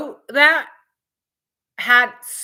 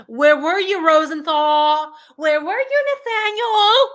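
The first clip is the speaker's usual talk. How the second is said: rising furious yell, getting louder; angry shout; 8 semitones higher, 7 dB louder than usual. Real, not acted. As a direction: yell it out, louder each time. Yelling for the missing cop.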